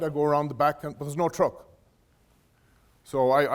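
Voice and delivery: ordinary talk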